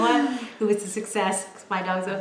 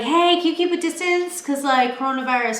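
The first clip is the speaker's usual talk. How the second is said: high, sing-song polite ask; hypothetical request; 5 semitones higher than usual. Pretend. Neighbor asked to keep distance, high and polite. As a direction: ask the neighbor sweetly and awkwardly. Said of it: "how am I gonna be like".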